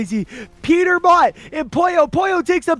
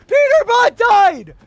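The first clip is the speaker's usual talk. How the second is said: screaming the call, higher and louder; excited shout; 10 semitones higher, 8 dB louder than usual. Real, not acted. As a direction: erupt with real hype as he falls. Star player eliminated, caster erupts.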